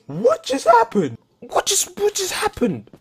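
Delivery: high, slow stunned question; shocked disbelief. Real, not acted. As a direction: ask it in total disbelief. Disbelief at sudden hit.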